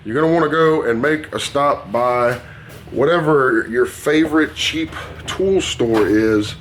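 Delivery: ordinary talk, nothing marked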